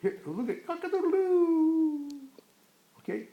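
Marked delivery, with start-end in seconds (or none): 0.7-2.1 high playful cock-a-doodle-doo; rooster crow. Pretend. Parent showing a child the rooster, high playful crow. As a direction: crow like a rooster, drawing out the last note.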